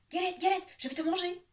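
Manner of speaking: high, loud rabbit cry; rabbit shouting. Acted. The rabbit shouts at the pancake, high and loud. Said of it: "crie le lapin".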